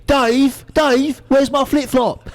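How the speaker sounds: put-on high, slow English tourist accent; mock English whine. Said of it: "as opposed to the English".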